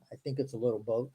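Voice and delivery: ordinary talk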